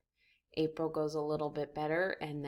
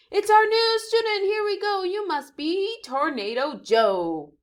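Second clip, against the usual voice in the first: much higher, loud excited teacher; excited welcome; 12 semitones higher, 9 dB louder than usual. Acted. Teacher greeting Tornado Joe, excited and loud. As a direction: greet him with a big burst.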